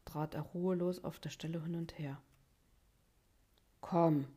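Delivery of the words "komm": firm call. A narrator's reading. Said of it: "sagte er schließlich".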